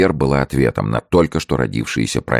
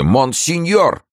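very high, drawn-out call; urgent shout; slower than usual. A narrator's reading. Gourville reaching monseigneur with news, shouting. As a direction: stretch the call out, pitch up high. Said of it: "крикнул Гурвиль".

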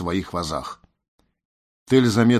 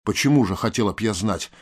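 usual speaking voice

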